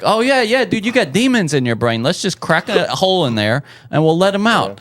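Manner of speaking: lightly voiced, breezily confident quack; old-time doctor. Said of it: "back when they was like".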